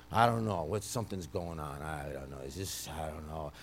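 dropping to a baffled murmur; puzzled mumble. Retold or imitated. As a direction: trail off, lost for words. Puzzled coworkers voiced by preacher.

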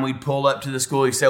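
ordinary delivery

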